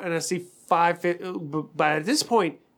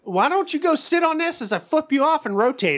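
raised-pitch exasperated rant; mock irate retort; 7 semitones higher than usual. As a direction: act out a fed-up comeback, starting high. Acted retort to morning greeter.

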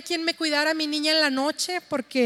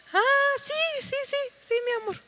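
high, fading, offhand yes-yes; tired dismissive dad; 10 semitones higher than usual. Retold or imitated. Exhausted husband brushing off his daughter: high, trailing mock reply. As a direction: brush her off like a worn-out father.

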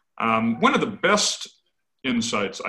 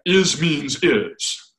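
both "is" hit hard, the first one higher and louder; stress on is; 4 semitones higher, 5 dB louder, much slower than usual. Retold or imitated. Luther insisting at Marburg, hammering the word "is". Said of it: "Luther was so insistent".

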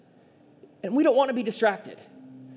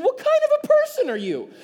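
high, loud, playfully scandalized question; mock outrage; 6 dB louder than usual. Pastor retelling a chat with his wife, mock outraged. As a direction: ham up the shock, much higher and louder.

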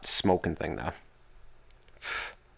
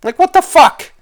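much higher, louder exasperated outburst; high, loud fed-up cry; voice cracking high; 8 dB louder than usual. Real, not acted. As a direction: shriek it in disbelief. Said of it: "My voice got a little bit high on that".

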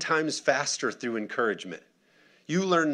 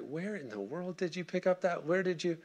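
higher, hushed disbelief; soft, high-pitched mock astonishment; incredulous parent; 6 semitones higher, 6 dB quieter than usual. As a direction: imitate a baffled parent questioning a child. Parent questioning kid's words, acted.

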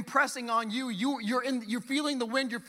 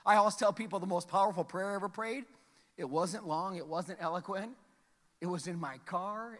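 ordinary delivery

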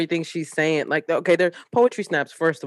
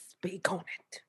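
breathy whispered echo; playful whispered echo to her friend; 13 dB quieter, slower than usual. Pretend. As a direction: whisper it back teasingly. Repeats it as a whisper.

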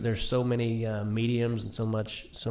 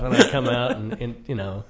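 usual speaking voice

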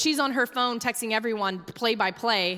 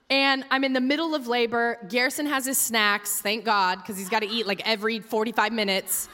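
ordinary delivery

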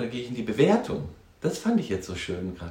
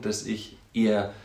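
ordinary delivery

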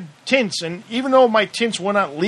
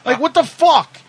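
higher, half-laughing outrage; 6 semitones higher than usual. Retold or imitated. Driver scoffing at the officers while still in cuffs, incredulous. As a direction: scoff at him in disbelief. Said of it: "I said".

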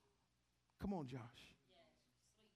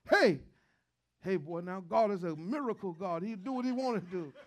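dad's louder, half-joking holler; imitating dad; 8 dB louder than usual. Son voices his father warning about God, louder. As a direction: call out like an old dad scolding the boy. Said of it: "That's my dad".

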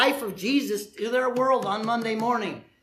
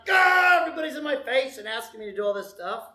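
hammed-up harried complaint; mock frazzled outburst; 3 semitones higher, faster than usual. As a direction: blurt it out fast, overwhelmed. Mock Monday stress rant.

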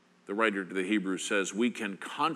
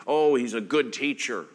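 louder, higher voice of the doubters; a lightly voiced dismissive speaker; mild mock of the casual skeptic; what people say; 8 semitones higher, 7 dB louder than usual. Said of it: "People may tell you".

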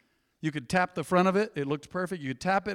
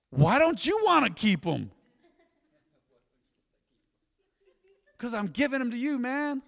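surprised, cheerful exchange; 6 semitones higher, 4 dB louder than usual. Retold or imitated. Preacher acting out a shoe gift, higher and louder. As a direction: act out a friend's happy surprise. Acted exchange while giving shoes.

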